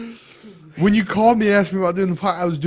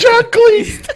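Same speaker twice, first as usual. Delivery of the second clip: laughing exclamation; 7 dB louder than usual. Real, not acted.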